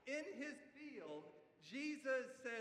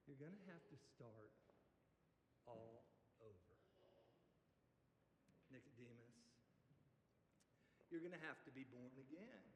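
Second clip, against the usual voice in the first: softer, much lower, every word weighted; hushed and solemn; 10 semitones lower, 16 dB quieter than usual. Acted. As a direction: let it sink in quietly. Preacher drops voice for weight.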